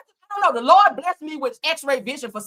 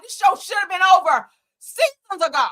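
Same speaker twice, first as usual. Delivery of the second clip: usual speaking voice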